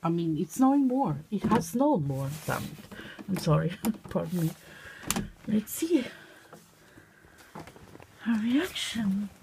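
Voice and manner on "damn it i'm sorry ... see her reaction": ordinary delivery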